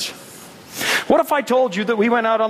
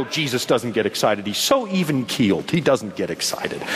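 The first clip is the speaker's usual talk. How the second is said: low-pitched, unexcited doubter; mock objection; 7 semitones lower than usual. Preacher voices a doubter to rebut, low mock objection. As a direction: drop the pitch, dismissively even-keeled.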